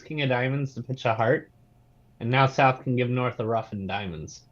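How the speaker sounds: normal talking voice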